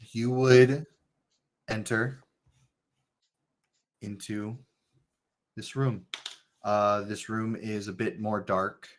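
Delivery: low, quiet, slow scene setting; scene narration. Acted. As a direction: set the dark room calmly. GM sets the scene.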